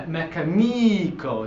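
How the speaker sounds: mecanico with a long stressed NI, "meh-ka-NEE-ko"; misplaced stress on NI. Shown as a demonstration. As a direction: lean hard on the second-to-last syllable of mecanico. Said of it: "it should be really on".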